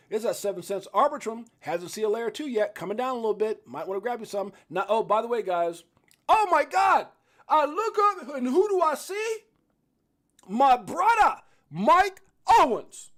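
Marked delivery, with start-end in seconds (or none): 6.3-13.1 loud, high, slow welcome; thrilled to see his brother join; much higher, louder announcer welcome; excited greeting. Real, not acted. Greeting friend who joined.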